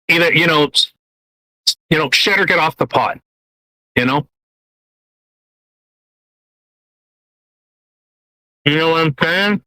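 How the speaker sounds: talking through a mouthful of cookie; words muffled by food; mouth full. Real, not acted. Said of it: "I couldn't understand you with the food in your mouth".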